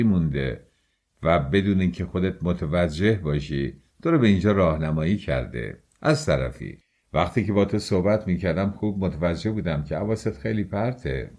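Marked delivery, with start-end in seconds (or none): none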